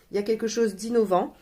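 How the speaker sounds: ordinary talk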